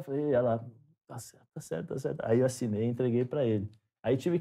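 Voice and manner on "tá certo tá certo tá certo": quick, soft "tá certo" muttered to him; hushed reassurance. Retold or imitated. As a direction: murmur it so only he hears. Wife quietly confirms his autograph. (sussurrando baixinho para o marido)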